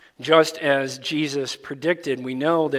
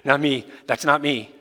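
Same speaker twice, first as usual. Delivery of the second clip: panicked denial; 3 dB louder than usual. Retold or imitated. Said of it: "And Peter goes".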